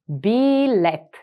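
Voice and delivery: Билет drawn out slowly; slow, careful Билет. Shown as a demonstration. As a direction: stretch Билет out for the learner. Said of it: "teacher repeats word slowly".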